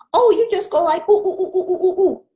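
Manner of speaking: put-on high, excited child's monkey voice; imitating a child. Child showing the teacher a monkey sound, high and excited. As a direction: play a kid showing off the monkey noise. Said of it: "How do you make a monkey sound?".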